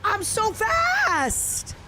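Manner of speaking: high, very slow gleeful boast. Real, not acted. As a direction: stretch it out with delight. Gleeful, drawn out.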